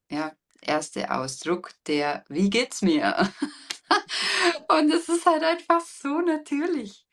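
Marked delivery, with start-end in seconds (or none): 2.3-7.1 high, loud, laughing exclamation; loud high-pitched playful squeal with laughter; playful laughing outburst. Acted. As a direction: burst into a bright high laugh while talking. Acts newborn's first cry, laughing.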